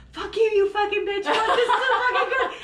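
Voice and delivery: high, loud gushing through laughter; excited mock swearing. Friend gushing over a birthday gift, hammed-up excited swearing. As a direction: re-enact yourself shrieking curses in delight.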